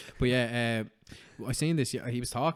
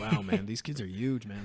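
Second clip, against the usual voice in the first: slowed, lightly put-on Joe Rogan voice; a loose take on a podcast host marvelling; Joe Rogan impression. Mocking Joe Rogan's awe to a friend, a light impression. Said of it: "that was very Joe Rogan-esque there".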